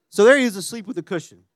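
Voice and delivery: ordinary talk, nothing marked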